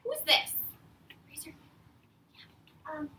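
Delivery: ordinary talk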